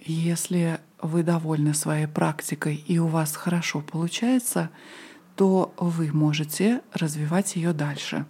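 ordinary talk, nothing marked

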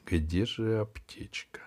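тихо, почти шёпотом; бормочи себе под нос шёпотом; whispering to himself. Acted. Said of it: "рассуждая вслух". (шёпот себе под нос)

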